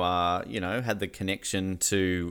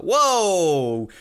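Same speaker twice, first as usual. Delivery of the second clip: high, loud startled whoa; stunned double take, pitched up; shocked realisation; 8 semitones higher, 5 dB louder, much slower than usual. Retold or imitated. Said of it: "And you're like".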